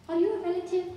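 high, loud woman's voice; woman's question. Acted. Woman from nearby shop asking the narrator, inquiring.

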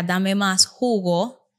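very slow, clearly spaced prompt; model phrase. Shown as a demonstration. Therapist modeling the phrase for the child, very slow. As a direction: spell it out slowly for a child. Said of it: "lo primero que yo espero es que Raquel me diga".

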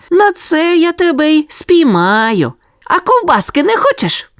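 sly little dog. A narrator's reading. Sonya lures the worker with sausage, sly high voice. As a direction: tempt him slyly with sausage, high. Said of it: "запитала Соня".

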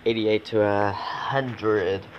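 ordinary talk, nothing marked

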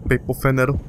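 ordinary talk

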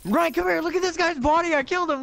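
high-pitched, amazed shout to a friend; thrilled, high, disbelieving outburst; excited calling out. Real, not acted. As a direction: call them over to gawk at your kill. Calls friend over to see kill.

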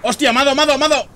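shouting in shock at being hit; panicked shout. Real, not acted. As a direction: yell it, high and loud, just hit. Player just got shot in game.

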